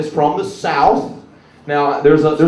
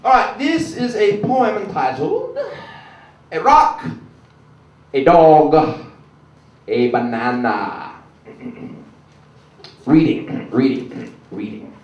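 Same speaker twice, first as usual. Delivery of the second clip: slow, grand poetry reading; Maya Angelou poetry reading; 4 semitones higher, 3 dB louder, slower than usual. Pretend. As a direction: read each line slow and grand. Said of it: "The real Maya Angelou".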